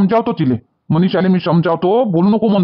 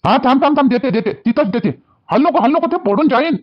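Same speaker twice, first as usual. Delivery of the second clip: higher, hurried pleas to stop moving; urgent calling out; 6 semitones higher than usual. Acted. Aunt urging the child to keep still, urgently. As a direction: call out quickly so the child doesn't fall.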